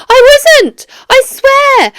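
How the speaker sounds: high, loud, desperate denial; panicked denial. Acted. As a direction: blurt it out like he's cornered. Suspect pleads innocence under pressure.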